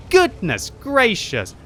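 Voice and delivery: drawn-out high exclaim; amazed. Real, not acted. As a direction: stretch it out in awe. Caster amazed by a kill.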